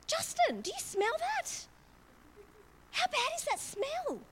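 high, exaggerated outraged sniffing mum; hammed-up parent complaining about a stink; mock disgusted mum. Mum teasing her kids about a stinky attitude, high mock disgust. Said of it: "It goes like this".

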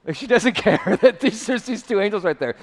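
high, laughing, incredulous delivery; amused disbelief. Real, not acted. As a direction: laugh through it, pitched well up. Preacher amused by Mary's indifference.

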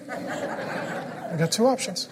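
slow, voiceless, laughing mimicry; mocking child echo. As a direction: echo it back like a cheeky kid. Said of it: "child mimics dad's words".

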